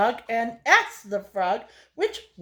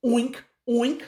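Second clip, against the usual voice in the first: pig oink. Acted. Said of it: "the hog answered".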